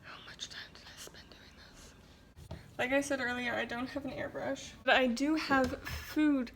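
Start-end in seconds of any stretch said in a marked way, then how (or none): 0.0-2.6 soft aside whisper. Real, not acted. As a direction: whisper it to yourself.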